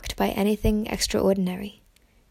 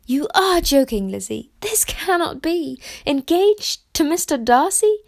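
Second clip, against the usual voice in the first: high, disbelieving cry of a sister who cannot credit it; incredulous disbelief; 7 semitones higher, 3 dB louder than usual. Acted. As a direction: refuse to believe it, pitched up and pushing back. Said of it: "she was absolutely incredulous here".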